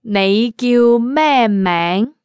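usual speaking voice

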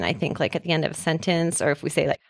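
ordinary talk

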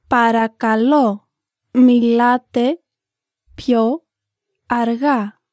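slow, careful learner-paced reading; slowly. Shown as a demonstration. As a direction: spell each phrase out slowly for students. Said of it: "Let's hear it slowly now".

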